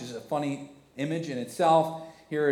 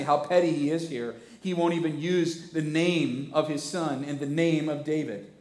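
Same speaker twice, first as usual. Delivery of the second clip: ordinary talk, nothing marked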